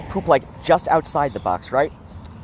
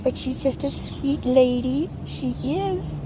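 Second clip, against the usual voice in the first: much higher Upper Midwest Yooper lilt; Yooper accent; 9 semitones higher, 4 dB quieter, slower than usual. Acted. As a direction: chirp it like a Yooper lady. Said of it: "a little bit more like a Yooper".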